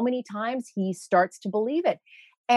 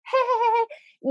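much higher, sing-song and teasing; mock chirpy host; 13 semitones higher than usual. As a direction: imitate a breezy host laughing it off. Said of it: "And Sunny with her".

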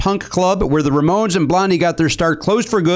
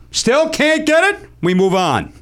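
slow, higher teasing drawl; drawn-out dramatic tease; 9 semitones higher, slower than usual. Acted. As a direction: stretch it out like a game show host. Host repeats rule dramatically.

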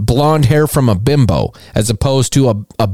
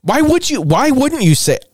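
incredulous; 12 semitones higher than usual. Real, not acted. Incredulous at the witness quote.

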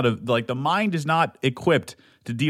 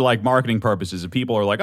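usual speaking voice

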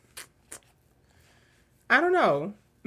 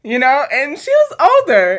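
ordinary talk, nothing marked

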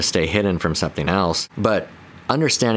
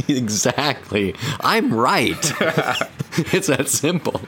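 laughing mock certainty; 6 semitones higher, slower than usual. Joins the joke with laughs.

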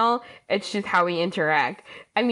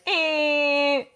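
short higher honk; lame horn imitation; 6 semitones higher than usual. Mocking her own wheelchair's feeble horn, a higher bleat. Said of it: "really lame horn that's like".

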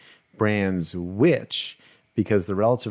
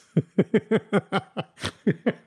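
long high giggling laugh; laughter; 11 semitones higher than usual. Real, not acted. Laughing over a dropped-off package, high giggles. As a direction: break into high, rapid laughter.

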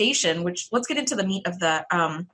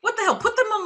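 what the hell, pitched way up in real disbelief; incredulous, much higher; 12 semitones higher than usual. Real, not acted. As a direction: squeak it out, the outrage peaking. Repeats louder in disbelief.

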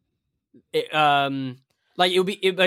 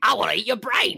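evil goblin voice; 6 semitones higher than usual. Retold or imitated. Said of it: "evil little high-pitched Cockney voice".

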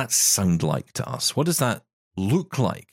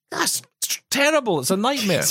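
dismayed complainer; 12 semitones higher than usual. Retold or imitated. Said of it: "until it happens to you and you go".

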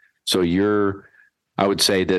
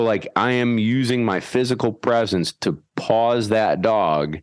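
usual speaking voice

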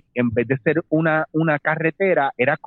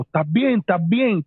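higher-pitched, urgent retold check; concerned passerby; 6 semitones higher than usual. Stranger who stopped to help him, retold with concern. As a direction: imitate a stranger quickly checking on someone.

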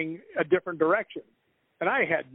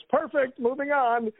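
higher-pitched mock director call; imitating Richard; 10 semitones higher, slower than usual. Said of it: "Richard looks up and he goes".

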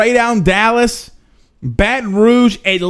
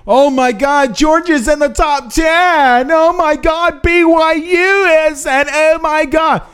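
whiny mock outrage, much higher and stretched; mock complaining; 8 semitones higher, slower than usual. Mocking fans who complain about the rankings, whiny high outcry. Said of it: "Everyone's complaining".

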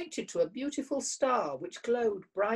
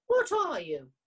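higher, louder, eager asking; curious question; 7 semitones higher, 5 dB louder than usual. Acted. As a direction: lean in with wonder. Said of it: "asked Fox, curiously".

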